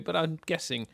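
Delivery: usual speaking voice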